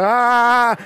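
high mock scream on a ride; imitated scream. Dad re-enacts his ride photo screams, mock scream. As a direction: imitate yourself screaming on a roller coaster. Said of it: "I do scream".

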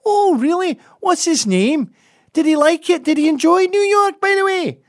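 loud, very high caricature Scot; mock Scottish accent. Sarcastically asking after a local's cousin, mock Scottish accent. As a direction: feign keen interest in a loud, high Scottish voice.

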